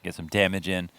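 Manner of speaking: ordinary delivery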